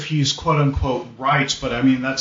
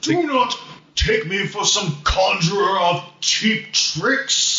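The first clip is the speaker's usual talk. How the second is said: a light Gandalf impression, slow and a bit higher; slow mock wizard threat; Gandalf's booming voice; 6 semitones higher, slower than usual. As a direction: quote Gandalf with mock menace, slowly. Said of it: "Gandalf has to do the voice".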